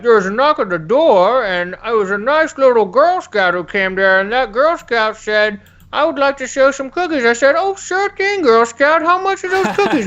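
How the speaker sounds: hammed-up storyteller dad. Chef's dad telling the Girl Scout story in a high hammed-up voice. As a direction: spin a tall tale in a big cartoon voice. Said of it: "Chef's dad would be like".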